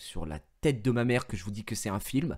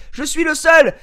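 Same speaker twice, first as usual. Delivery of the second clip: high, loud indignation; loud complaint; 15 dB louder, slower than usual. Retold or imitated. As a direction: insist he's the only one unpaid. Complaining to Olivier. (plainte forte et indignée)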